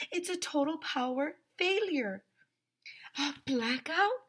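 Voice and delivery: high, dramatic cry of alarm. A narrator's reading. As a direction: pitch it way up in disbelief. Characters alarmed by power failure.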